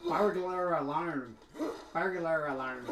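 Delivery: slow, drawn-out Scottish pronunciation of "burglar alarm"; Scottish accent attempt. Shown as a demonstration. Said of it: "say it very well with the Scottish".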